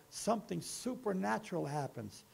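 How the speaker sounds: ordinary delivery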